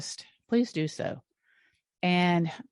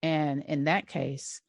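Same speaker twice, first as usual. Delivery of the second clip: ordinary talk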